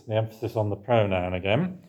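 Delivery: ordinary delivery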